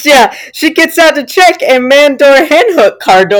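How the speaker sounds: high voice on the verge of cracking up; fighting back laughter. Real, not acted. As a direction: struggle through the punchline, tickled. Cracking up at the punchline.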